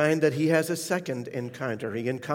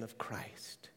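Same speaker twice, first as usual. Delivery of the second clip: whispered, much softer; 4 semitones lower, 15 dB quieter than usual. Real, not acted. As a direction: breathe the words out. End of conversion story.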